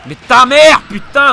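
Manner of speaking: angry shout. Real, not acted. Player cursing mid-fight, angry shout. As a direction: explode into a curse.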